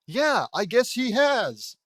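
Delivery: loud, high, drawn-out exasperation; exasperated outburst. Real, not acted. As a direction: blurt it out slowly in loud disbelief. Exasperated reaction to bad writing.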